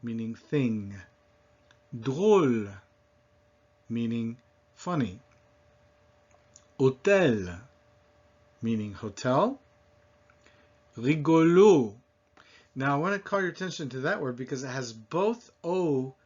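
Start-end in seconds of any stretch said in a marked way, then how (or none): 1.9-2.7 Drôle with closed O and French r; long rounded O in Drôle; French pronunciation model. Shown as a demonstration. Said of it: "repeat after me". 6.8-7.6 Hôtel with silent h and closed O; French Hôtel, oh-TEL; French pronunciation model. Shown as a demonstration. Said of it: "repeat after me". 11.0-11.9 Rigolo with French r, closed final O; French pronunciation model. Shown as a demonstration. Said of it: "repeat after me".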